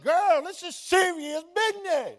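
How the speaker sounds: high, loud, comic protest to a partner; hammed-up squawk of indignation; mock arguing. Husband trying to argue with his laughing wife, mock indignation. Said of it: "We've tried to argue before".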